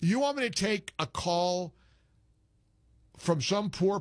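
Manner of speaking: ordinary talk, nothing marked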